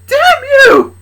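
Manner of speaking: high, loud, stretched scream; panicked. Acted. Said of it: "in panic, she said to him".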